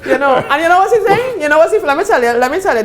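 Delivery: laughing while winding up a story. Real, not acted. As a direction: talk through the laugh, eager. Laughing, building a joke.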